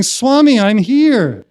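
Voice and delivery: loud, high retold cry for attention; desperate plea. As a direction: plead desperately to be noticed. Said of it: "More desperate".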